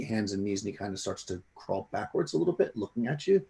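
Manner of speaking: ordinary talk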